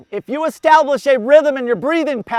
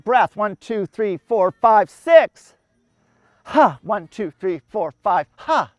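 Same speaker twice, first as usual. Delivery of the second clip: rhythmic count with a push on six; six-count demo; slower than usual. Shown as a demonstration. Coach demonstrates bilateral breathing count, rhythmic counting. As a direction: count it out, hitting the breath beats. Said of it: "That's a six count".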